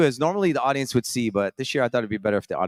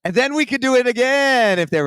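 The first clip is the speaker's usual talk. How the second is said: excited; 13 semitones higher, 7 dB louder than usual. Real, not acted. Excited high burst about recording.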